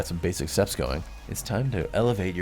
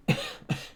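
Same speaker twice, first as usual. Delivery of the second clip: spirit coughing; 4 dB quieter than usual. Acted. Spirit chokes on spice.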